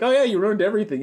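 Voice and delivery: high, rushed mock outburst; blunt outburst. Reviewer acting out Starlight's rudeness to Pinky, mock blunt outburst. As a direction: imitate a blurted, too-honest remark.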